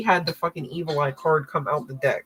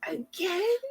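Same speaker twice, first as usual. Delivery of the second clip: surprised question; 12 semitones higher, 6 dB quieter than usual. Real, not acted. Reader surprised the card repeats.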